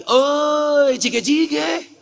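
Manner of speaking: high, long call, no real volume; imitated calling out. Man calls to the preacher at the hotel, mock calling out. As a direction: sing out a high mock call, rising at the end. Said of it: "Bapaknya teriak lagi".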